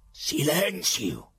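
high, slow, loud command; furious shout. Acted. Pilate silencing the prisoner, high slow furious shout. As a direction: cut him off with a roar. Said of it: "gridò Pilato".

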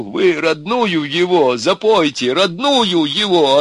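loud, high, drawn-out urging. Acted. Ivan retells urging them to sing, insistent and loud.

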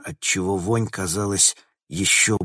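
plain reading voice

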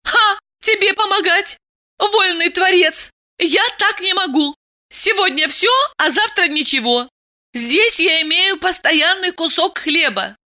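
high-pitched protesting woman; indignant Diana. Acted. Woman objects to moving with lover, indignant. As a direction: fire indignant questions up high.

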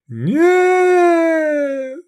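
long, high, anguished scream; drawn-out full-voiced yell. A narrator's reading. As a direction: scream it out in horror. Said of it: "заорал Лобанов".

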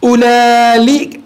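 أولئك stretched slowly, with the added lam dragged as ulali; slow demo of a forbidden form; slowly drawn out. Shown as a demonstration. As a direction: spell out the wrong أولئك slowly for the class. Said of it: "فلا يجوز أن تقول".

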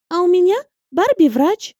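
put-on high, cheeky child's boast; a little girl outdoing friends. A narrator's reading. Girls boast about dolls.

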